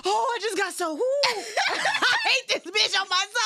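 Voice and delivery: high laughing outburst of hate; laughing mad. Real, not acted. Venting about [name], laughing angry outburst. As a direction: laugh through the fury.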